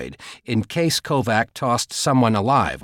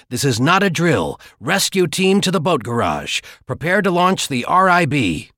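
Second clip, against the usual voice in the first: urgent intercom orders; 6 semitones higher, 4 dB louder than usual. Acted. Alarm over intercom.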